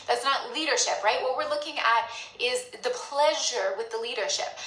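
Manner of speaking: ordinary talk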